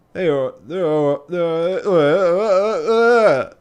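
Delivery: loud, high garbled groaning; garbled artificer. Acted. Garbled, exaggerated Yorkshire artificer reply.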